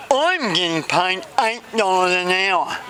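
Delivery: high, slow, mush-mouthed; garbled character voice. Acted. Friends compare pay, slow high garbled voice. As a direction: draw it out in a high put-on voice.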